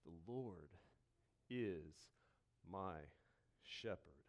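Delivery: the pastor lets the psalm sink in, hushed and slow; slow quiet emphasis. Shown as a demonstration. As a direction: lay each word down gently, slowly. Adding weight to the truth.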